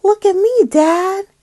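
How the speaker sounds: high, loud eager shout; bright kid calling look at me; excited child call. Acted. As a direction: call out proudly to dad. Tad calls out.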